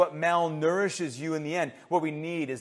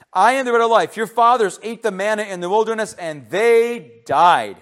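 loud, raised pulpit reading; emphatic scripture preaching; 7 semitones higher, 11 dB louder than usual. Real, not acted. Preacher reads Jesus' claim to congregation, emphatic scripture preaching. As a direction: declare the verse and quiz the congregation.

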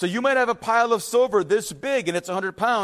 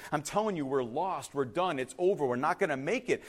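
ordinary talk

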